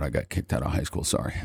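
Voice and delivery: sheepish murmur. Real, not acted. Confessing, dropping voice.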